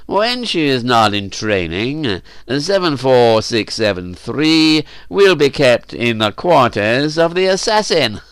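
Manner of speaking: slow, emphatic pronouncement; drawn-out decree of a master; Cernus the master. Acted. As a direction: pass sentence deliberately, relishing each word.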